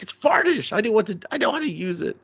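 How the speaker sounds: high, fast flustered excuse; flustered kid. Retold or imitated. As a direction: rush the excuse out.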